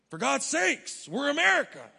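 loud, high, hammed-up outrage; mock indignation. American expecting everything translated into English, mock indignation. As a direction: protest loudly as an entitled American.

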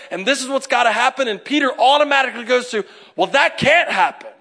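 loud, high and driving sermon; full-force preaching. Acted. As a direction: preach it out to the congregation.

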